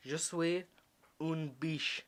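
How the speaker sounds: halting French with careful pauses; attempted French. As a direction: try saying it in French, haltingly. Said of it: "His attempt at French".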